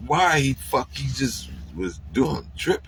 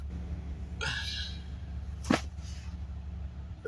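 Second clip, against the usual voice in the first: quiet laugh; 15 dB quieter than usual. Real, not acted. Laughing at his friend's fear.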